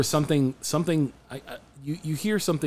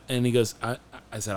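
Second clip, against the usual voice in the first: ordinary talk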